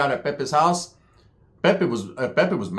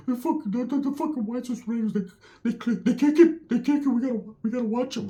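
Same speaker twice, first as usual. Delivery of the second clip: pitched way up, rattled; high paranoid Ricky; 8 semitones higher, 5 dB quieter than usual. Retold or imitated. Ricky warning about the whites, high and paranoid. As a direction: warn in a high nervous voice. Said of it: "He's like".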